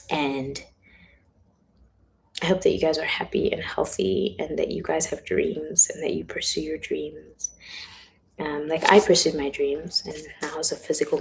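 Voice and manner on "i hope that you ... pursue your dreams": ordinary talk, nothing marked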